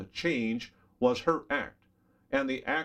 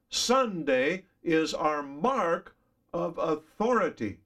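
each word laid out slowly; slow, weighty quotation; slow emphatic reading; 5 semitones higher, 3 dB louder, slower than usual. Shown as a demonstration. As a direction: read it out deliberately, stressing mark. Re-reads quote slowly, stressed.

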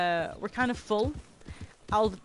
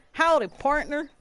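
put-on southern accent; 7 semitones higher, 7 dB louder than usual. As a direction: try a big Southern cowboy greeting, amused. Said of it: "like, a southern accent?".

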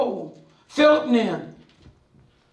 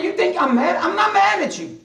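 high-pitched comic denial; playful self-defence, pitched up; mock-indignant protest; 8 semitones higher than usual. Acted protest to a complaining sister.

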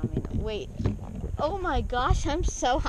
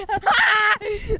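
crying laugh-shout; 7 semitones higher than usual. Real, not acted. Spinning, crying out.

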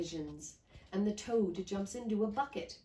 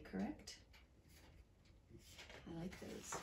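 usual speaking voice

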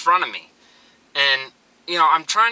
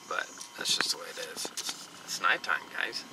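hushed unvoiced whisper, softer; 9 semitones lower, 13 dB quieter than usual. Real, not acted. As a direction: whisper it so nobody wakes. Early morning, quiet before the gym.

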